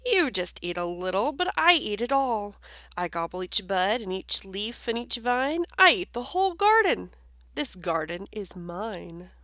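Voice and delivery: loud, greedy woodchuck voice. Acted. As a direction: brag about eating it all. Woodchuck claims the garden.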